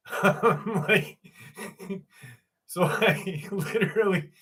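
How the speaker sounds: breaking up laughing mid-sentence; can't get the words out for laughing; real laughter while retelling. Real, not acted. Cracking up at story.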